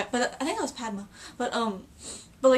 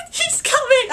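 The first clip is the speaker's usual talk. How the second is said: high, loud thrilled cry; retold fan excitement; excited squeal; 9 dB louder than usual. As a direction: squeal with anticipation. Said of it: "and then I'm just like".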